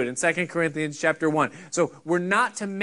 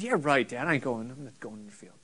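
dismissive son; 4 semitones lower, 6 dB quieter than usual. Acted. Other son refusing his father.

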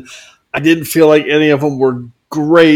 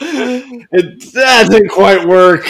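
laughing; 7 semitones higher, 5 dB louder, much slower than usual. Real, not acted. Laughing at own limerick.

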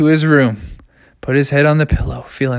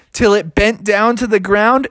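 ordinary delivery

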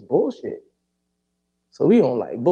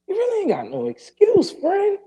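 pleading; 13 semitones higher than usual. Retold or imitated. Calling out his friend.

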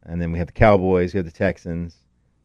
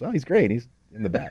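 mock boast; 9 semitones higher, 4 dB quieter than usual. Mock answer about his horse.

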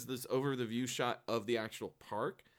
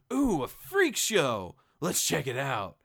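louder, higher, slow ooh; mock excited; 4 semitones higher, 6 dB louder, slower than usual. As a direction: gush at the sign. Girl spots freak show.

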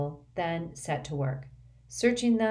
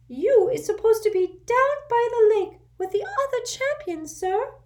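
much higher, louder squeaky elf voice; urgent little servant squeaking; an anxious house elf waking his master; 7 dB louder than usual. A narrator's reading. Dobby voiced.

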